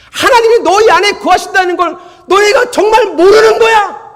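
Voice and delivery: loud, high exasperated preaching; loud and high, half laughing in disbelief; preaching at full force. Acted. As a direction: pour out the apostle's rebuke. Preaches the rebuke.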